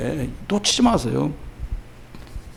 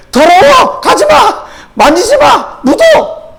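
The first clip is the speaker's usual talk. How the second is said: very high, loud acted yelps; mother's scolding cry; 5 dB louder, much slower than usual. Mother warning a freshly dressed toddler off dirt, high alarmed shouting. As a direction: call out sharp warnings in a high voice.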